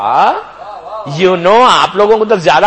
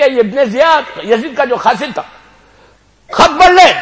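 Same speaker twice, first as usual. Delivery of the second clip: calling out; 8 semitones higher, 6 dB louder than usual. Retold or imitated. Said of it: "پکار کے کہا".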